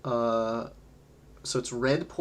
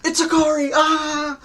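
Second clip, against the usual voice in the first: excited greeting; 7 dB louder than usual. Real, not acted.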